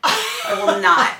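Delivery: high, loud, laughing "I will not"; giggling defiant vow; laughing refusal. Real, not acted. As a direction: swear it through a laugh. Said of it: "laughing vow".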